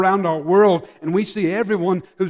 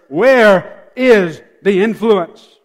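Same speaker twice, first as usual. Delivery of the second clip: emphatic slow demand; 7 dB louder, much slower than usual. Acted. Preacher's climactic demand.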